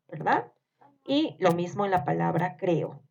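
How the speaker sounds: ordinary talk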